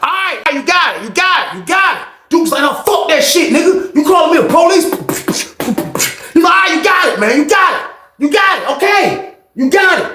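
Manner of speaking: mock yelling fight. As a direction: yell through the fight. Fight sounds retold.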